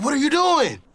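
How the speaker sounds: high-pitched, alarmed demand; startled officer. Retold or imitated. Police officer walks up closer, startled high challenge. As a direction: pitch it way up, then drop at the end.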